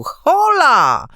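sudden high, loud shout of alarm; calling out. A narrator's reading. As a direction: bark it out to stop an intruder. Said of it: "zawołał".

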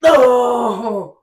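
loud, high cry of exertion; strained yell. Real, not acted. Tired lifter struggling to finish the set, strained yell. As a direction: wail it out under a heavy load. Failing rep at end of set.

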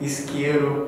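isqueiro with a clear s, ees-keiro; São Paulo accent. Shown as a demonstration. Explaining Brazilian accents, São Paulo pronunciation of isqueiro. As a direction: Paulista isqueiro, hissed s. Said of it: "in São Paulo, they say".

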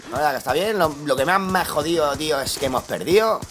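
ordinary delivery

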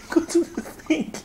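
laughing high, slow order. Real, not acted.

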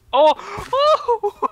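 delighted squeal. Real, not acted. Delighted at baby chicken.

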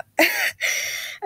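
airy, whispered giggle; breathy laugh. Real, not acted. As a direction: let out a quick breathless laugh. Laughs at her own intro.

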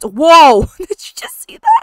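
loud, high startled cry breaking into a laugh; startled whoa. Real, not acted. Player shocked as Lilith suddenly appears. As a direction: react like it caught you off guard.

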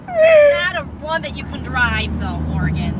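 loud blurt. Real, not acted. Sibling blurts the letdown.

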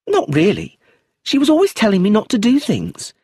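put-on high, loud careless child. A narrator's reading. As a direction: shrug off the dead nanny. Josephine voiced as child.

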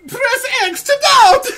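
high, slow shouted meme line. Pretend. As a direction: yell it like a game prompt.

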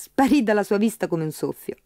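ordinary delivery

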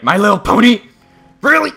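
high, loud disbelieving cry; incredulous. Real, not acted. Gamer seeing My Little Pony, incredulous yell. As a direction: squawk it in disbelief. Disbelief at what appears in game.

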